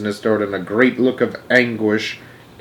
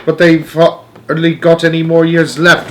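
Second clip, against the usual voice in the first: put-on old man's raised, dismayed wailing; 6 semitones higher, 6 dB louder than usual. A narrator's reading. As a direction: wail it loudly in dismay. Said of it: "wailed Grandpa Joe".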